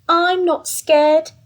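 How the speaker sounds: loud, slow defiant claim; brave little boast. Acted. Gruffalo's child insisting she's brave, loud and slow. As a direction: puff up bravely. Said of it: "said that Gruffalo's child".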